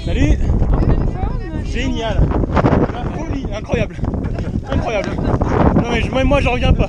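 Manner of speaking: loud, high, thrilled; overjoyed shout at the checkpoint; excited. Real, not acted. Excited runner greets people at checkpoint.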